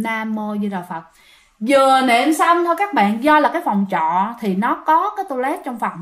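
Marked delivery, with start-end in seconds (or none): 0.0-1.0 hushed Buddhist chant; low-key retold praying; softly recited prayer. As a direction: murmur the prayer to yourself. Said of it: "thì bạn niệm".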